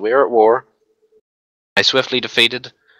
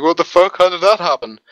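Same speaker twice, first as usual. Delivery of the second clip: mock panic, pitched a bit higher; panicking friend; 7 semitones higher, 3 dB louder than usual. Friend mimicking a panicking drunk ally, hammed-up alarm. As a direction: imitate him freaking out as the attack lands. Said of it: "Hieronymus then was panicking".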